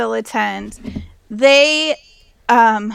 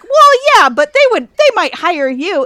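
high, loud mock-dad objection, settling slightly; imitating dad's protest; 7 semitones higher, 8 dB louder than usual. Daughter retelling dad's defense of their church, imitated high insistence. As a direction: play dad insisting, pitched up and punchy. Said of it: "my dad will be like".

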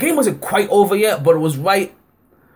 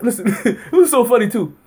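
normal talking voice

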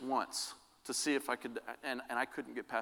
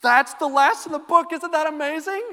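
much higher, much louder burst of wonder; can't contain the awe; amazed; 16 dB louder than usual. Real, not acted. Pastor marvelling to the church at the book's ending, thrilled. Marvels after finishing the reading.

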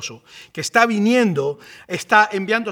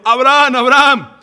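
urgent cry from heaven, voice lifted; shouted call; 4 semitones higher, 6 dB louder than usual. Acted. The angel calls to Abraham from heaven, shouting. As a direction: call out loud and higher from above. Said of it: "le gritó desde el cielo".

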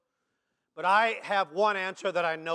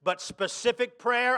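normal talking voice

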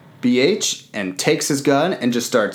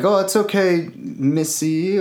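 higher, cooing mock comfort; mock coddling; 8 semitones higher than usual. Billy Zane soothes the stolen child, cooing higher. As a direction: coo fake comfort at the child.

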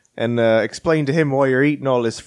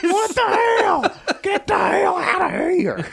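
high, slow laughing mock shout; hammed-up bouncer throwing them out, half laughing; a laughing mock yell at unruly diners; mock outrage; slower than usual.

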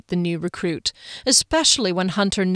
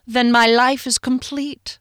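higher, mock-pleased sarcastic reply; 5 semitones higher, 4 dB louder than usual. A narrator's reading. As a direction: say it with dry irony, pitched up. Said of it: "she replied sarcastically".

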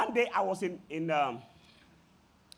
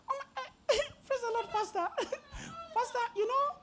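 gushing churchgoer; 5 dB quieter, faster than usual. Retold or imitated.

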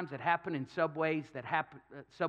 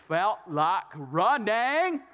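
slow, drawn-out, louder dreamy voice; Forrest Gump; 3 semitones higher, 6 dB louder, much slower than usual. Retold or imitated. Preacher doing the runner for the congregation, slow, drawn-out and louder. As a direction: draw it out slow like a simple-hearted runner. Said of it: "the spirit of Forrest Gump came on him".

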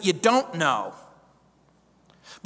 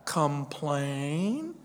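mock naive thought; 3 dB quieter than usual. Preacher voicing the congregation's mock surprise. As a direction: play the naive listener lightly. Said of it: "You might be thinking".

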